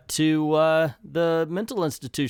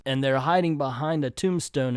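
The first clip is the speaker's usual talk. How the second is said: plain reading voice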